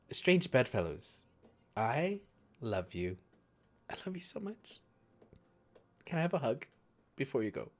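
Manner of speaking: put-on quiet, tender drunken goodbye; soft tender farewell. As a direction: whisper it fondly, almost crying. NPC tearful drunk farewell.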